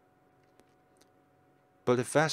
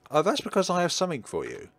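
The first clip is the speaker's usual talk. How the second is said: put-on eager boy voice; 8 semitones higher, faster than usual. Scarecrow boy explains visit.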